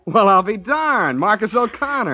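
pleasantly surprised. Acted. Old army pal greets friend.

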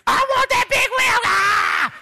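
very high, loud acted kid tantrum; child's screaming tantrum. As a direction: scream it like a child throwing a fit. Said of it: "fits and temper tantrums and screaming and hollering".

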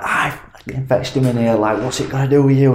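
a hint of a furious father defending his son; angry dad. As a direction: retell dad losing his temper, mock heated. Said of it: "my dad instantly lost his head".

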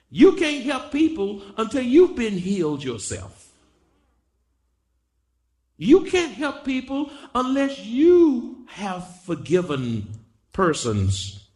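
forceful preaching. Real, not acted. Preacher exhorts those who would help people, high and forceful. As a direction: press each phrase on the congregation.

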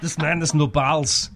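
put-on slow Austrian accent with P turned to B; lightly done Arnold Austrian accent, slowly; Schwarzenegger Austrian accent. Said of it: "his particular Austrian accent".

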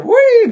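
very high, loud, stretched wee; Mario-style "wee" squeal. Retold or imitated. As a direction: squeal it like a game sound effect. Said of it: "そういうなんか効果音". (甲高く伸ばした「ウィー」)

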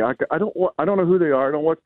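normal talking voice

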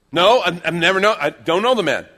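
loud, high-pitched protest; emphatic denial. Acted. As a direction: deny it forcefully, stumbling over the words. Peter denies knowing Jesus.

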